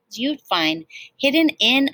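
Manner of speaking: usual speaking voice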